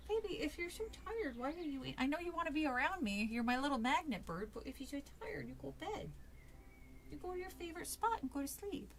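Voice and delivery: soft, doting aside; tender cooing. Real, not acted. Reader tells tired baby to go sleep, tender cooing. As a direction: gently coax a little one off to bed. Reader gently sends pet to bed.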